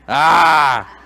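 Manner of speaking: a sudden loud playful yelp; mock yelp. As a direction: startle them with a loud cry. Mock shock at hand raise.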